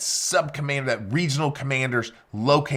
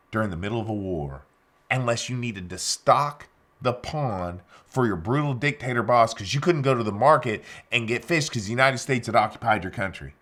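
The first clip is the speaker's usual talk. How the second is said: normal talking voice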